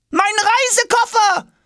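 high, stretched-out wail; pleading call. A narrator's reading. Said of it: "ruft Jonas flehend hinterher". (flehend hinterherrufen)

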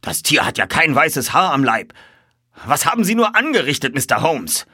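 high, loud, agitated reproach; exclaiming. A narrator's reading. Colonel reproaching the detective over his horse, exclaiming. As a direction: burst out accusingly. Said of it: "rief der Oberst".

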